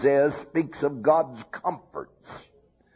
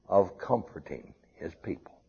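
whispered; 6 semitones lower, 7 dB quieter, faster than usual. Acted. Phrase falls to whisper.

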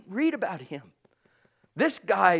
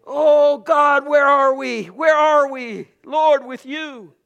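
loud, slow impassioned plea; crying out to God; 8 semitones higher, 10 dB louder, slower than usual. Acted. Preacher pleading with God before the congregation, loud and slow. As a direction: stretch each word out in fervent prayer.

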